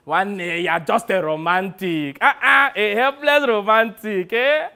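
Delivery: drawn-out teasing, climbing high and loud; Sonny, scoffing. Acted. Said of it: "Every time I say things like this".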